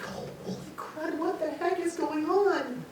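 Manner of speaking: high, slow, airy bewilderment; shaken disbelief. Retold or imitated. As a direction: re-enact stunned alarm, drawn out. Shaking on bed after the voice.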